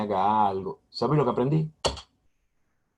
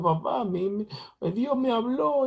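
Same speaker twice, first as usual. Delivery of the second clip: put-on high, excited girl's voice; squeaky-high voiced daughter announcing God spoke; daughter's excited voice; 14 semitones higher than usual. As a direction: play the daughter breaking happy news. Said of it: "mi hija me llamó y me dijo".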